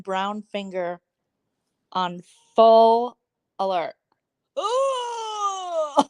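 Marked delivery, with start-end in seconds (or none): 4.6-6.0 high "ooh" of disgust; disgusted squeal. Real, not acted. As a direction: squeal at something gross. Opens gross picture.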